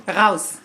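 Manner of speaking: German "Raus" said with a rolled-back r and a long ow, low; German command. Shown as a demonstration. To Johnny, switching to German, a low "Raus". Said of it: "should I say it in German".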